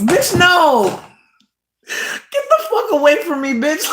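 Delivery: high, loud mock cry of disgust; high whiny fake cry of disgust; mock outrage. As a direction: shriek them away in fake horror. Rejecting app offer.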